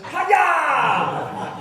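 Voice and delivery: high, loud chop shout breaking into a laugh; kid's karate yell. Retold or imitated. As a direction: yell it like a kid copying his hero, laughing. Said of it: "Todd stands up ... and goes".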